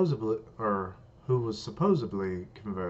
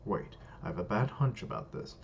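plain reading voice

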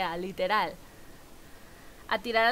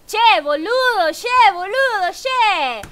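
hammed-up Argentine accent, louder and higher, slow; loud mock Argentine accent; 7 semitones higher, 10 dB louder, slower than usual. Streamer shows chat her Argentine accent, hammed-up loud and high. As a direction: belt out a playful fake Argentine accent. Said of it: "el acento".

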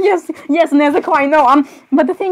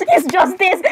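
much higher-pitched second try; flustered restart; 5 semitones higher, slower than usual. Acted. Repeats it after being questioned.

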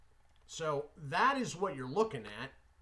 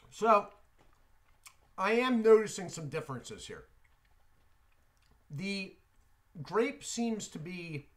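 talking around gum; gum-filled mouth speech; mouth chewing gum; 3 semitones higher, 5 dB louder than usual. Real, not acted. Seen 1: bubble blown just before; jaw and lip shapes suggest chewing.

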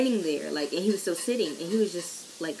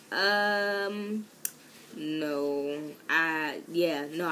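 retold fumbling with a drawn-out um; bad liar stalling; much slower than usual. Student retelling being asked about an ignored text, awkward stalling. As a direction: stall haltingly, caught in a fib. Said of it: "I'm not good with lying".

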